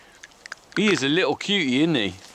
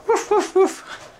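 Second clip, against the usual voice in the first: slow, much higher playful woof woof woof; mock barking; much slower than usual. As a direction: bark on the dog's behalf for fun. Barking for the dog to viewers.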